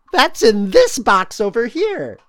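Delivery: high, loud, teasing; spooky teasing voice. Acted. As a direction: tease the hidden box with a spooky lilt.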